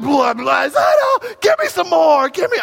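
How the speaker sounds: very high, loud screaming taunt; little girl screaming. Retold or imitated. Little girl screaming taunts at the ocean waves. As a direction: shriek like a tiny daredevil. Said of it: "She's a little girl screaming".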